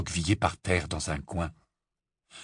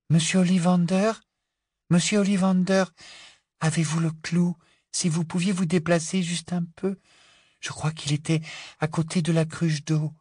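put-on high, singsong girl's voice; Luna, polite and gentle; 8 semitones higher than usual. A narrator's reading. Luna asking old prisoner for the nail, gentle politeness. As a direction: ask politely and serenely, up high.

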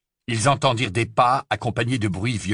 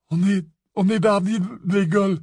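stammering lie; 9 semitones higher than usual. Acted.

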